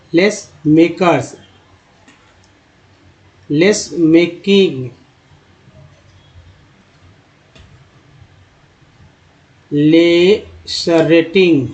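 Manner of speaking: each word said slowly and clearly for learners; slow word-list reading. Shown as a demonstration. Teacher reading a word list slowly to students. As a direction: spell out the vocabulary slowly, word by word.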